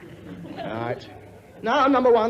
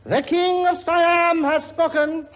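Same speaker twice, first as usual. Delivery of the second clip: royal pronouncement; 9 semitones higher, much slower than usual. Acted. King's grand pronouncement.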